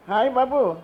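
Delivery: put-on a playful lilt to charm a child; lilting sing-song. Retelling how she greeted the boy, in a lilt. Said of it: "એ પણ મારી જેમ લહેકાતી જ બોલ્યો".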